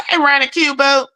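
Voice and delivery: ordinary talk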